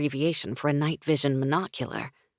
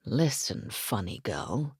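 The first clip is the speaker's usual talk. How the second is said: teasing; 5 semitones lower, 5 dB quieter than usual. Acted. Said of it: "he teased".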